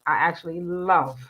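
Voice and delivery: ordinary talk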